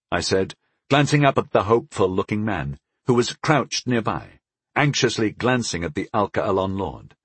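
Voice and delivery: usual speaking voice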